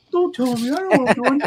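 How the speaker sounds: high, loud indignant protest. Retold or imitated. Steve insisting he can handle the chicken, high indignant protest. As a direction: snap back high and offended. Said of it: "And he's like".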